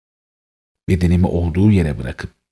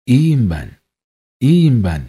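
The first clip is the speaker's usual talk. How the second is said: high, raised-voice cry; shouting with last strength; 7 semitones higher, 4 dB louder than usual. A narrator's reading. The dead narrator reassuring the mourning family, crying out. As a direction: call out with all remaining strength. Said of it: "bağırıyorum son gücümle".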